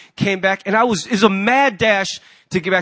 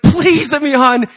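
louder, slow, out of breath from sprinting; panting to the flight attendant; breathless plea; 6 semitones higher, 7 dB louder than usual. Retold or imitated. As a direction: beg the attendant while panting. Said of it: "I'm puffing and huffing".

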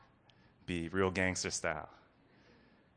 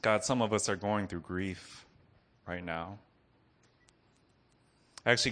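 prayer. Acted. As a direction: pray over the room gently. Leads congregation in prayer.

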